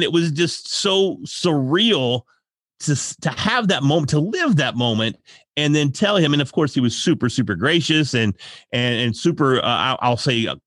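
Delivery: ordinary talk, nothing marked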